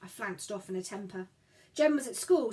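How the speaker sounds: ordinary delivery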